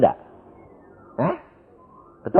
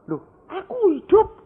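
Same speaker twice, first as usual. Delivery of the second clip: surprised revived man; 4 dB louder than usual. Retold or imitated.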